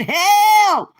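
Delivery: high, loud imitation of panicked help cries; mocking a friend's joke, loud and high; mock scream. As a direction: ham up a failing liver crying out. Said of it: "Nora said".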